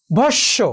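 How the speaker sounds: ভস্য said as bhosh-sho, with a double sh; careful demonstration of doubled sh in ভস্য; doubled consonant. Shown as a demonstration. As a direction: hold the sh in ভস্য, no m. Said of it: "দ্বিত্ব হয়েছে।".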